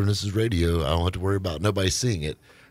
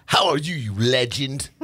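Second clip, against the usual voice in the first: put-on British accent; 6 semitones higher than usual. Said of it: "I read his text in his voice".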